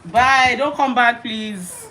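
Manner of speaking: loud mock-stern dismissal; loud shooing. Real, not acted. Shooing toddler away.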